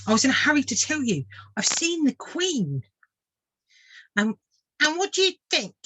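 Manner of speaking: put-on excitable young swift. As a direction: rush to share the big news. Swift tells his news.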